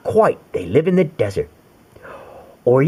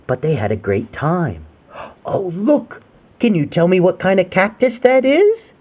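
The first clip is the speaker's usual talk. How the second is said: usual speaking voice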